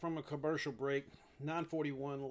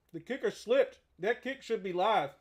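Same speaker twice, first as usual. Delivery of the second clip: high, loud excited call; 6 semitones higher, 7 dB louder than usual. Real, not acted. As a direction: call it out in surprise. Reacting to the kicker slipping.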